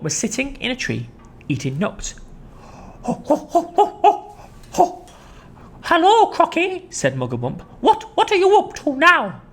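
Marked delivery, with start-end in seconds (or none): 3.0-5.1 much higher ho-ho laugh; monkey laugh. Acted. Mugglewump laughing at the crocodile. 5.8-6.9 much higher, louder greeting; cheeky monkey. Acted. Mugglewump greeting the crocodile. 7.8-9.4 much higher chattery voice; cheeky monkey. Acted. Mugglewump questioning the crocodile.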